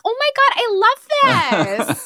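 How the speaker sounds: very high, slow, laughing fake delight; over-the-top mock enthusiasm through a laugh; mocking gushing fan. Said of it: "been like".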